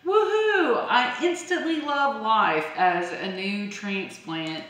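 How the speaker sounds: high, loud whoop easing into excited chatter; mock gleeful plant. Gardener voices a plant loving its new container, mock gleeful. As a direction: play a newly transplanted plant whooping with joy. Voicing a thriving new transplant.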